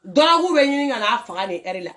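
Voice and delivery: usual speaking voice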